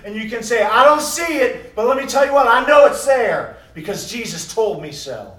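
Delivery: rising to loud, high preaching; preaching shout. Real, not acted. Preacher shouting the faith to the congregation. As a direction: build it up to a shouted climax.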